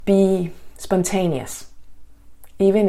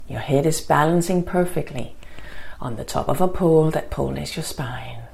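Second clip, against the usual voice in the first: guided meditation. Acted. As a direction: ease them into calm, gently.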